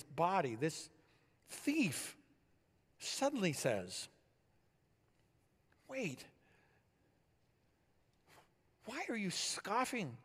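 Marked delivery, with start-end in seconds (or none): 5.9-10.2 drawn-out, higher-pitched rebuke. Acted. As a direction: slowly challenge a scoffer, pitched up. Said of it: "He rebuked the other".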